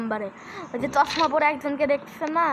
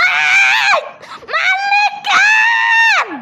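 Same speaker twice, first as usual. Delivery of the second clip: very slow, high, loud outcry; angry shout; 10 dB louder, much slower than usual. Real, not acted. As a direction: wail at being killed. Yelling after being killed. (রাগী চিৎকার)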